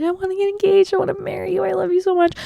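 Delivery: put-on high, soft, pleading girlfriend voice; mock whiny pleading. Girlfriend bugging Steve to propose, high whiny plea.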